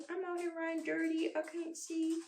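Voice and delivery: high, soft little pet voice; small, high mock lament; voicing the pet. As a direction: playfully complain as the shaggy pet. Speaks for the shaggy pet.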